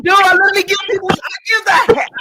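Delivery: loud, high, heated shouting over him; real heated yell; angry shout. Real, not acted. Heated panel argument, shouting.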